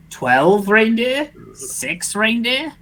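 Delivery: high, slow unsure guesses; rising questioning. Acted. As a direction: stretch each guess upward. Guessing reindeer count.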